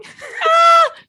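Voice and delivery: sudden high laugh, embarrassed; loud burst of laughter. Real, not acted. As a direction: burst out laughing at your own answer. Laughing about the kissing.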